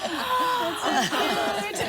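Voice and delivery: big, high audible gasp in; exaggerated toddler-style deep inhale; demonstrated deep breath. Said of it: "And she went".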